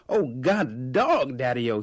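slow, loud blustering exclamation; sudden bluster. A narrator's reading. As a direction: blow up like a hot-headed vendor. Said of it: "he said with a sudden bluster".

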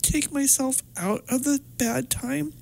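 high, teary voice, holding on through it; tearful. Real, not acted. Client recalling leaving the bad time, tearful. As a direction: keep talking through real tears, pitched high. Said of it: "I'm totally crying".

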